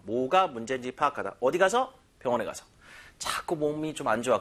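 ordinary talk, nothing marked

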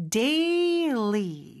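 "daily" drawn out slowly, syllable by syllable; demonstrated pronunciation. Shown as a demonstration. Teacher modelling for learners, "daily" slow and stretched. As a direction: say "daily" slowly with a long first vowel. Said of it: "이렇게 발음해 주시고".

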